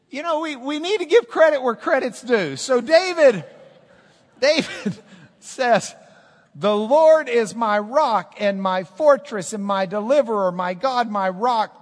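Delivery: loud, high proclamation of the psalm; full-force preaching. Acted. Pastor declares David's psalm to the church, preaching loud. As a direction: proclaim every word to the back row.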